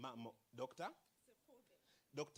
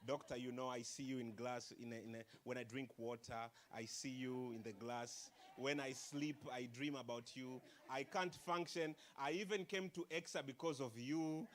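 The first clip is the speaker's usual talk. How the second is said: smooth seductive charm; 8 semitones lower, 4 dB quieter than usual. Retold or imitated. Suitor sweet-talking the doctor, lower and soft. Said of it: "First, they do like".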